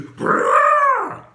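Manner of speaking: a high, long, drawn-out brrrr like a revving car; car engine noise. Retold or imitated. Mimicking drivers racing down empty streets, engine brrrr. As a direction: rev it like a speeding car.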